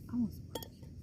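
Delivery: soft pleading whisper; whispered craving. Retold or imitated. Pregnant patient begging the nurse for barbecue, whispered. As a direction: whisper the craving under your breath. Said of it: "And I'm just like".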